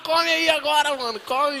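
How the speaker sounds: high, loud, laughing call; joyful shout. Retold or imitated. Handing a lunch to someone, a high laughing shout.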